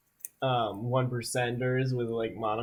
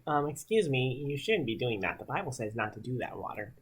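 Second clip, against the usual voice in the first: prim quiet voice; 8 dB quieter than usual. Retold or imitated. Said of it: "he's allowed to, like, say".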